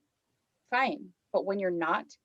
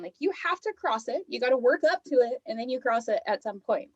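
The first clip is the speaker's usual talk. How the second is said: sassy; 6 semitones higher than usual. Retold or imitated. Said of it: "I go".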